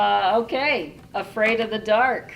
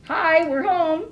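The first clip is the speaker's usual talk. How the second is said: dad voice; 7 semitones higher, 5 dB louder than usual. Acted. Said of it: "he said".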